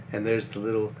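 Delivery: plain reading voice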